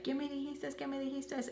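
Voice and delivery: lightly mocking impression of the girl; mocking her. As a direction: parody her begging in a little put-on voice. Imitating her earlier demand.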